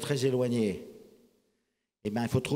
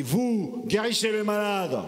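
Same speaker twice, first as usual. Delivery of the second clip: quoted command; 8 semitones higher, 4 dB louder, slower than usual. Retold or imitated. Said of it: "quand Gilles Boucaumont a dit".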